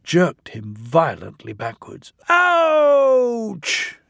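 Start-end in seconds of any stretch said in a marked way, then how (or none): 2.3-4.0 long, very high, loud "ouch"; pained cry. A narrator's reading. Engine is jolted backwards in the crash, crying out. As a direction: yelp it out, drawn out.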